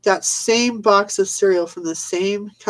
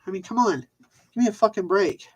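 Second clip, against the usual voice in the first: normal talking voice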